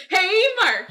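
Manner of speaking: high, loud, drawn-out greeting; mimicked intro shout. Guest suggesting a phone prank, high loud call. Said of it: "start the phone conversation with".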